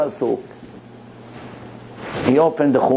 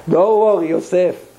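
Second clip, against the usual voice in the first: Ami's way of talking; 4 semitones higher than usual. Retold or imitated. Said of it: "That's the way he talks".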